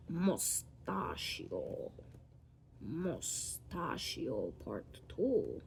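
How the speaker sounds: very slow, stretched-out syllables; drawn-out mock announcement. Vlogger naming each moustache stroke, playfully drawn out. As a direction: stretch each word grandly as you draw.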